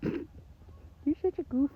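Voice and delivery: fond cooing to a pet. Real, not acted. Cooing to her horse.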